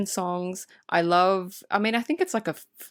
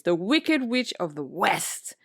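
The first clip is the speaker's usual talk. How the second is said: slow, grand build to the last word; drawn-out, theatrical introduction; dramatic villain announcement; slower than usual. Acted. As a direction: announce a villain's big entrance. Hyping the villain's entrance.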